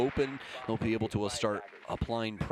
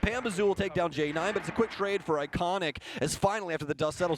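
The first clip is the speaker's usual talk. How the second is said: excited play-by-play; 8 semitones higher than usual. Real, not acted. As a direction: call the clutch like the crowd's on its feet. Clutch moment in live casting.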